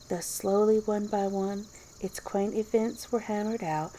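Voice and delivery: usual speaking voice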